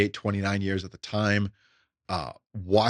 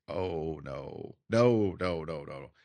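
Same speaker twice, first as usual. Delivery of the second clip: dismayed quiet no; 4 semitones lower, 6 dB quieter, slower than usual. Retold or imitated. Teller's dismay at the story.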